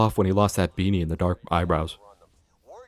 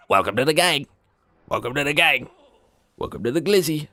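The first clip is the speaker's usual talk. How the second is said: put-on catchphrase voice; 5 semitones higher than usual.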